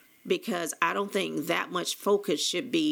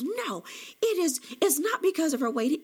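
high-pitched, raised mock yell; retold yelling; 10 semitones higher than usual. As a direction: imitate him shouting back in denial. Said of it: "And he was screaming".

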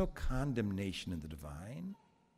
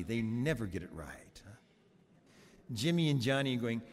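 ordinary talk, nothing marked

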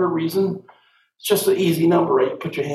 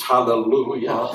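usual speaking voice

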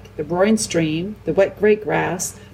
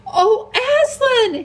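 very high, drawn-out cry; excited cry; 4 dB louder, slower than usual. A narrator's reading. Edmund on seeing the lion at last, excited cry. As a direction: cry out the name, stretched and high. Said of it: "he cried".

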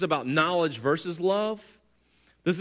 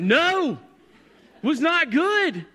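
high, emphatic mock-angry refusal; indignant reply; 9 semitones higher, 5 dB louder, slower than usual. Husband answering his wife after the hike, mock indignant. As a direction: snap it back high and loud, acting fed up.

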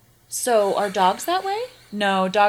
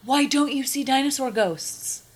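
surprised gasp; 7 semitones higher than usual. Real, not acted. Surprised by dinosaur ghost idea.

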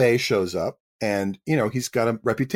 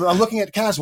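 usual speaking voice

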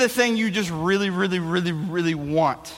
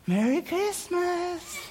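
slow, soft, higher drowsy greeting; sleepy parents; 10 semitones higher, 8 dB quieter, much slower than usual. Retold or imitated. Half-asleep parents greeting the kids, drowsy.

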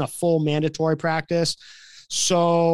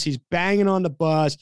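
normal talking voice

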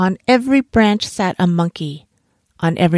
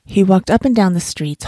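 ordinary delivery

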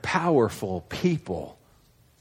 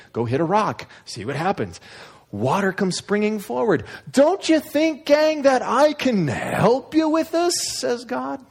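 exasperated God; 11 semitones higher than usual. Acted. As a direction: list every favour like a tired parent. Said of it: "He's a tad bit exasperated".

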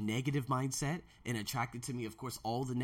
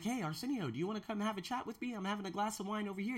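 higher, soft, quick friendly invite; imitated friend inviting; 6 semitones higher, 5 dB quieter, faster than usual. Friend invites him for wine, casual friendly voice. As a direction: lightly mimic a friend calling you over. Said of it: "Someone's gonna be like".

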